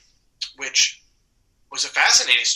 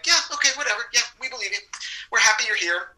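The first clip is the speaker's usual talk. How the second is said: high, offhand reassurance; lightly acted easygoing approval; breezy agreement; 9 semitones higher, faster than usual. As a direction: wave it off agreeably in a raised voice. Acts out easygoing agreeable crew.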